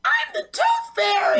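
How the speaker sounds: very high, slow, squeaky fairy voice; hammed-up, sky-high fairy voice; Tooth Fairy. Shown as a demonstration. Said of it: "I was doing the, um, Tooth Fairy".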